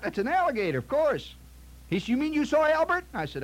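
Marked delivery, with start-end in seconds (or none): 0.0-1.3 high-pitched exasperated disbelief; incredulous. Acted. Club host answering the guest's silly question, incredulous. 2.1-3.0 high-pitched, stunned question; can hardly believe what you're hearing; astonished. Acted. Host learning the guest met the club alligator, astonished.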